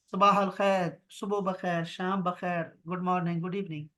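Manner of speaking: ordinary delivery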